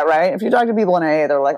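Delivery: ordinary talk, nothing marked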